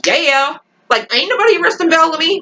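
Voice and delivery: high-pitched sassy defiance; defiant cheer. Acted. Fan cheering Indra on against Jackson, defiant cheer. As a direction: crow it like you're backing the fighter.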